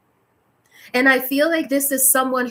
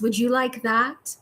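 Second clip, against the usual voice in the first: usual speaking voice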